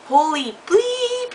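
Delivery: high, drawn-out stunned gasp of disbelief; shocked exclamation. Retold or imitated. God brother sees her grown up, stretched high shocked. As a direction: stretch it out high like you can't believe your eyes.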